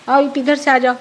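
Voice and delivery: normal talking voice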